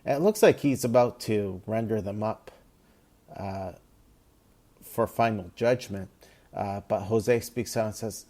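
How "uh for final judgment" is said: ordinary delivery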